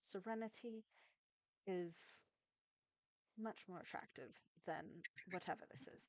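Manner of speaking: queen's hushed rebuke. Acted.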